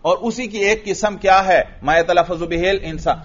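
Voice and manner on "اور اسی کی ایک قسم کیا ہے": ordinary delivery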